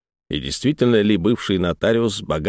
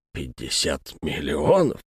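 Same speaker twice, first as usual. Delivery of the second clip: stunned; 8 semitones lower, 5 dB quieter, slower than usual. A narrator's reading. Notary repeats in shock.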